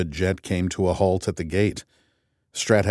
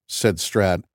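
ordinary delivery

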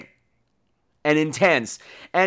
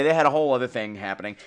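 ordinary talk

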